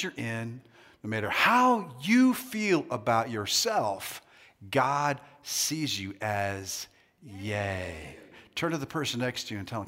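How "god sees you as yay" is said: soft, low and very slow; tender reassurance. Real, not acted. Preacher reassuring crowd how God sees them, slow and tender. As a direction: let each word land slowly and softly.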